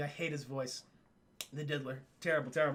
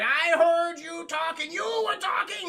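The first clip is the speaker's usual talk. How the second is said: high, slow and accusing; accusing villain voice; 8 semitones higher, 5 dB louder, slower than usual. Retold or imitated. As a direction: point the finger in a shrill voice. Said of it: "And they're like".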